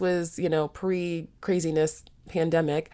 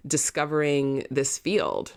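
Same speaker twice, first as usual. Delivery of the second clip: ordinary delivery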